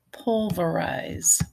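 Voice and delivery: pulverize drawn out, syllable by syllable; slow dictation. Shown as a demonstration. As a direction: stretch pulverize for a speller. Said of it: "A little bit more challenging".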